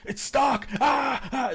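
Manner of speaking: high cries of pain trailing into yelps; hammed-up moaning shout. Shown as a demonstration. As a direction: wail it out in agony. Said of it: "Just be like".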